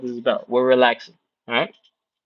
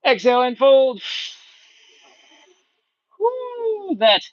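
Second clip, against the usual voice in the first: straining mid-stretch; 11 semitones higher, slower than usual. Real, not acted. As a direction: talk through the effort of the fold. Coach folding along with class.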